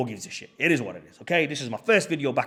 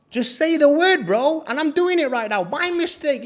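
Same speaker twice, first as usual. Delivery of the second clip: high-pitched, loud retold plea; exasperated pleading; 13 semitones higher, 6 dB louder than usual. Guest begging host for the door code, exasperated. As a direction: plead loudly, at the end of your patience.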